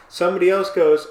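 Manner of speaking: ordinary talk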